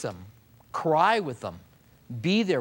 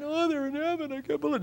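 mock wail; 10 semitones higher, 5 dB quieter, faster than usual. Parody of the mourner's crying.

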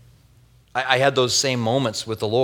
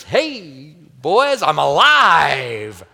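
mock grand entrance; 3 semitones higher, 5 dB louder, much slower than usual. Imagined Lazarus triumphant entrance.

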